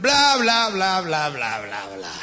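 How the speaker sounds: mocking chatter fading to nothing; mocking blah-blah. Husband mocking wife's talking, chatter fading out. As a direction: mimic her talk, trailing away dismissively. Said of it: "Así le hace el marido".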